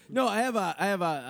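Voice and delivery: normal talking voice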